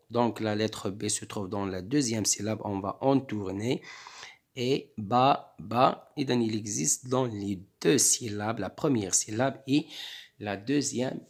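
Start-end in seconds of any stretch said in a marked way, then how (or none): none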